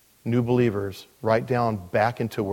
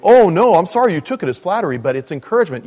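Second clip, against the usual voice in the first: high, loud mock-hurt "oh no"; sugary protest, much higher and louder at first; flatterer protesting; 5 semitones higher, 6 dB louder, faster than usual. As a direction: ham up a wounded flatterer, then let it settle. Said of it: "They go".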